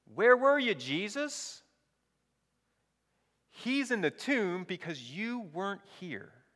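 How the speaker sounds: acted anguished blame, pitched well up; accusing. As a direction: cry out the accusation, starting loud. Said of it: "Do you hear the implicit accusation?".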